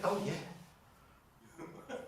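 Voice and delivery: very soft, hushed bluff; feigned knowing. Retold or imitated. Boy pretending to his friend he knows, hushed bluff. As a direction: murmur it as if you already knew.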